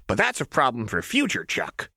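hint of manic Jinx; lightly voiced Jinx. A narrator's reading. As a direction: toy with a hostage, lightly.

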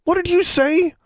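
very high, indignant question; offended demand. A narrator's reading. Boy insulted by a younger girl, offended. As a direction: demand it, pitch shooting up. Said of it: "David demanded, also offended".

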